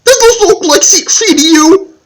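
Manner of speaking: hammed-up furious customer shouting; angry yelling. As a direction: yell it at the customer behind him. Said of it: "He started yelling".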